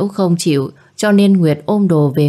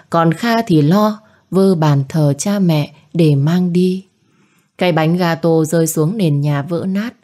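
usual speaking voice

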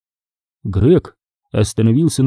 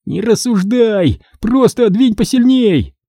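high-pitched urgent plea; pleading; 10 semitones higher than usual. A narrator's reading. Chick begging Greg to shove the door, pleading. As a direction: implore him, voice pitched high. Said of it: "умолял Цыпа".

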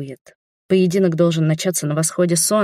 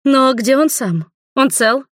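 high, urgent worried asking; anxious questions; 7 semitones higher, slower than usual. Acted. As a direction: press for news, worried. Anxious wife asks about husband.

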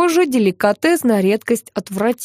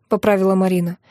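ordinary delivery, nothing marked

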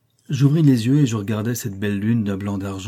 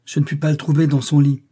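louder, higher exclamation; exclaiming colonel; 5 semitones higher, 5 dB louder than usual. A narrator's reading. Colonel searching for Rochester in the corridor, exclaiming. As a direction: call it out to everyone in alarm. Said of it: "s'écria le colonel Dent".

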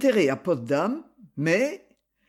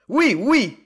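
loud hurried call of yes; shouted; 5 semitones higher, 5 dB louder than usual. Acted. Chamberlain answering an urgent bell, shouting. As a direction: call out over the ringing bell. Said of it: "cria-t-il".

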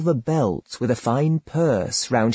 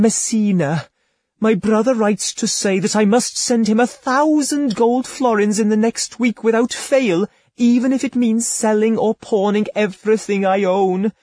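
higher, pleading lady's voice, laid on thick; tearful feigned despair; 9 semitones higher than usual. Acted. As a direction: beg him as if your brother's life hangs on it. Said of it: "she cried".

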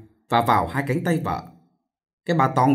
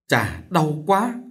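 slow, higher-pitched groan of hurt; moaning in pain; 4 semitones higher, much slower than usual. A narrator's reading. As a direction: drag the words out in pain. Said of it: "Cô suýt xoa". (suýt xoa đau đớn)

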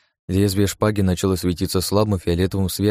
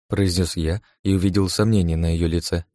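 ordinary delivery

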